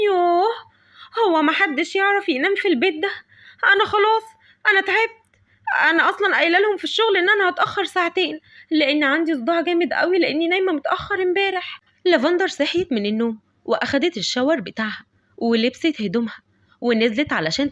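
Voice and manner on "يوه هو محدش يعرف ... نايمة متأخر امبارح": higher, whining half-tearful complaint; whiny grumbling.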